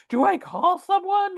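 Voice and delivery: frantic, high, rushing questions; freaking out. Retold or imitated. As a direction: sister on the clifftop, panicking about calling for help. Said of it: "freaking out".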